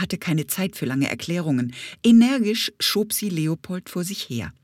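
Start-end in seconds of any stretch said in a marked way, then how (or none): none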